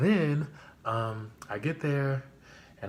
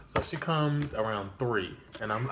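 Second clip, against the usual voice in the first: normal talking voice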